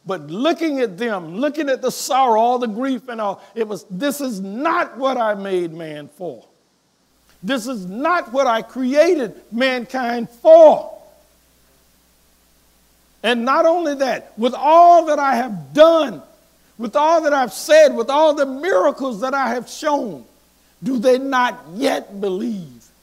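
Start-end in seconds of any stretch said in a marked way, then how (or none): 3.9-11.0 slow, building louder and higher; grieved declaration. Acted. Jesus seeing the mourners, slow and grieving. Preacher voicing God's sorrow. 13.2-22.9 loud, higher, building fervor; rising preaching climax. Real, not acted. Preacher pressing the congregation, loud and building.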